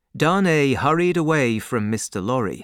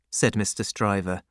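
plain reading voice

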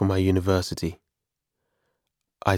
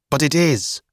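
raised, higher-pitched angry retort; 7 semitones higher, 4 dB louder than usual. A narrator's reading. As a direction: contradict him crossly, pitched up. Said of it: "I said angrily".

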